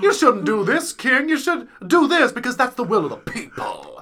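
lightly put-on Southern accent. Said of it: "he's got, like, a southern accent".

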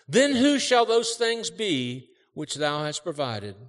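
fiery preacher voice, loud and high; preaching. Acted. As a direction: preach it with full force. Preacher reading parable at full force.

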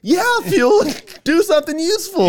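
very high, eager copycat yell; imitated echoing fan. Superfan repeating exactly what was yelled, eager yell. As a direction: parrot a heckle like an earnest superfan. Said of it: "our friend would repeat exactly".

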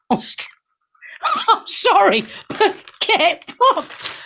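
high, slow, laughing disbelief; incredulous laughter. Real, not acted. Presenter reacting to a news story she finds absurd, incredulous laughing. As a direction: struggle to get it out through laughter.

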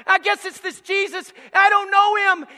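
high loud searching plea; loud seeker. Acted. Visitor at church asking about Jesus, loud. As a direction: call out, searching. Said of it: "you say".

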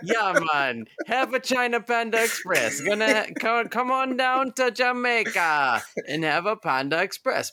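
playful put-on Jamaican accent ad read. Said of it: "in the Jamaican accent".

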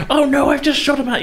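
high, hammed-up panicked wail; mock panic. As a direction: squeal it in fake horror. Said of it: "I'm not like".

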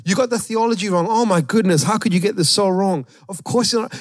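high, self-righteous correcting voice. Retold or imitated.

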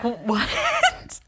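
stretched, laughing "what?"; incredulous drawn-out question; retold shock. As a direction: act out mock disbelief at yourself. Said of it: "And I was like".

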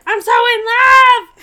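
high shrieking squeal of love; hammed-up gushing shout; mock fangirl scream. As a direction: scream it like a starstruck fan. Mock-fan squeal at Oprah.

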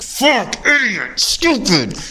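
high-pitched angry outburst at himself, retold; mock angry self-cursing. Venting at himself over the stolen sunglasses, mock angry. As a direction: spit out the insults at yourself, half acted. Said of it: "I let myself get wicked mad".

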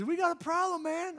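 a high, anxious voice for the friend on the phone; worried friend. Retold or imitated. Pastor imitating a youth pastor breaking bad news, high and anxious.

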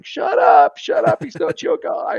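a hammed-up inner voice shushing you; mock Holy Spirit voice. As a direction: hiss it high and urgent like a nagging inner voice. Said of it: "the Holy Spirit's going".

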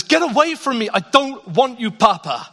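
acted toddler tantrum, high and loud; upset small child. Imitating his hurting son rejecting his father, loud tantrum. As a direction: push Papa away like a crying three-year-old. Retold upset three-year-old pushes father away.

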